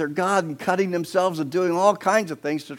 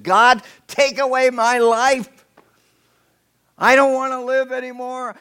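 loud, slow, anguished cry to God; despairing shout at God; angry outburst; 9 semitones higher, 12 dB louder than usual. Acted. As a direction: bellow it like a man at his limit. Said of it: "That's angry".

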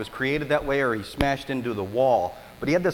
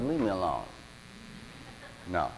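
groggy Lazarus; 10 semitones lower, 12 dB quieter than usual. Retold or imitated. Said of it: "Lazarus moaned and groaned".

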